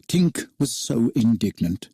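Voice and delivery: plain reading voice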